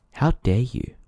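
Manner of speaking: ordinary talk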